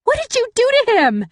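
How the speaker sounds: high shrill scream of accusation. Acted. Cass screams at narrator over her hurt boyfriend, loud scream. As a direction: scream it from across the street.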